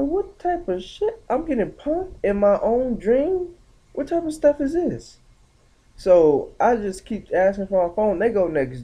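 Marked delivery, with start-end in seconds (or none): 0.0-5.2 outraged disbelief. Retold or imitated. To the girls who took his phone in the dream, shocked high-pitched disbelief. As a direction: screech it like you can't believe you're being robbed.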